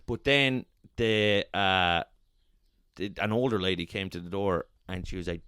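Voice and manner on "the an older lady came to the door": usual speaking voice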